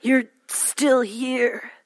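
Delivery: slow, weary drawn-out words; slow menacing. Acted. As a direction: draw it out slowly. Hero line slowly.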